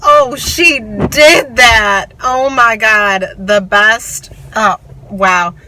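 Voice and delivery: loud, drawn-out, awestruck raving; thrilled outburst. Real, not acted. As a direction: burst out in slow, loud delight at the ending. Thrilled reaction to book's ending.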